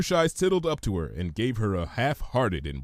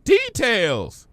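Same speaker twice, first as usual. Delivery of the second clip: higher, playful and bright; sparkly Pinky; 12 semitones higher, 5 dB louder than usual. Acted. Pinky brushing off Twilight, playful and higher. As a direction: toss it off cheekily. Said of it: "with a sparkle in her eye".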